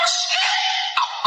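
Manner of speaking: breathy whispered curse in surprise; whispered shock. Retold or imitated. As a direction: let it slip out as a startled whisper. Shocked reaction, unvoiced far.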